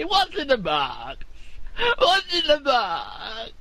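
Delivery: slow, rising, anguished Brad Pitt wail; drawn-out tearful movie anguish; Brad Pitt in Seven. Retold or imitated. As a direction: cry out like the end of Seven, slowly. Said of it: "no, I was doing Brad Pitt".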